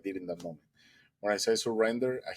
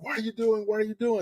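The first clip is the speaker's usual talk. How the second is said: screaming fisherman; 12 semitones higher than usual. Retold or imitated. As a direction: yell it at him in a panic. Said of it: "the guy starts screaming at me".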